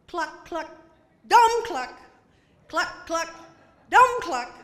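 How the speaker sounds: high clucks with loud dumb cluck; chicken clucking. Retold or imitated. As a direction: cluck back like a hen. Chicken voiced.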